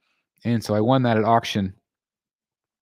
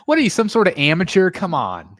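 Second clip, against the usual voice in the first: mock scolding; 7 semitones higher, 4 dB louder than usual.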